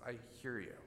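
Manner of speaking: gentle reassurance. Pretend.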